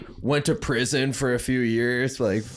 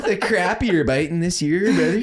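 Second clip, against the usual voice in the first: higher, louder, hammed-up Minnesota accent; Minnesota homies impression; 5 semitones higher, 3 dB louder than usual. Homies back home planning a lake trip, hammed-up Minnesota accent. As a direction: lay the Minnesota vowels on thick. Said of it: "all my homies were like".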